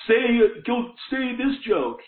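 heckler yelling out. Retold or imitated. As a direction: yell it from the crowd like a heckler, drawn out. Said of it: "this one guy yells out".

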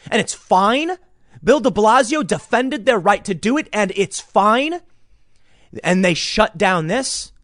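bitter sarcasm building to anger; sarcastic outrage. Real, not acted.

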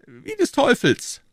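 high, lofty royal voice; a king speaking down to his subjects; king's voice. Acted. King to Treville, high lofty royal voice.

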